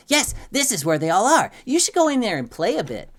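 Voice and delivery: high, loud eager twin with a smile; Squeak. A narrator's reading. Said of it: "Squeak's eyes go wide with a smile".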